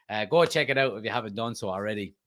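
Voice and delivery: usual speaking voice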